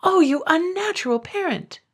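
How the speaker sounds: high shocked cry of a wife. Acted. Wife crying out in horror at her husband. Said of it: "she cried".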